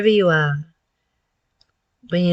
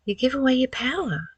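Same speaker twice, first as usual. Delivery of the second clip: ordinary delivery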